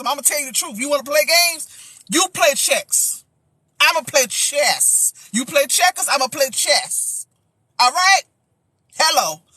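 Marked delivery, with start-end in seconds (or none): 2.1-7.3 slow, each word set down like a sermon point; slow preacher emphasis. Acted. Pastor telling a player the truth, slow and emphatic. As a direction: lay it out slowly, word by word. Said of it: "Look, I'm still a pastor".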